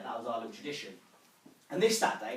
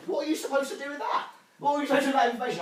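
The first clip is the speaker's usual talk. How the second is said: high, incredulous question, getting louder and faster; exasperated disbelief; 10 semitones higher, 6 dB louder, much faster than usual. Acted. Grandson reacting to nan's accent remark, exasperated. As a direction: throw it back in bafflement.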